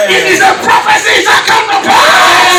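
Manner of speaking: pastor's shouted prophecy; full-force preaching. Real, not acted. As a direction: declare it certain over the church. Prophetic declaration.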